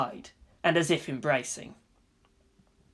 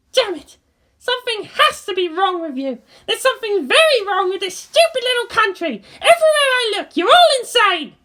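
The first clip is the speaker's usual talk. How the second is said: high, louder exasperated rant; angry tirade; 7 dB louder than usual. A narrator's reading. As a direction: vent at the whole country. Asuka's tirade.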